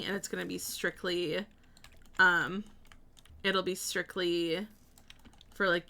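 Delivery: usual speaking voice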